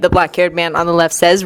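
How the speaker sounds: ordinary delivery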